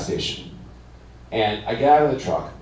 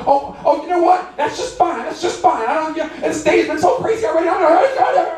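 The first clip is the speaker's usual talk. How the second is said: high, overwrought mock meltdown; woman melting down. Woman gives up arguing with the driver, high hammed-up rant. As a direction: imitate her working herself into a frenzy.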